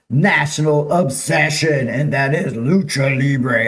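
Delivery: slow wrestling-announcer build-up. Acted. Reading out a friend's thrill-ride report, wrestling voice. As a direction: draw it out to a showy ring call. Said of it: "Am I gonna do it in my, uh, wrestling voice".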